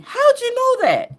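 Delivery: high astonished question, voice changed; amazed question. Retold or imitated. People asking her in a heartbeat, high astonished question. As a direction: squeak it out in disbelief. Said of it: "People will say to me in a heartbeat".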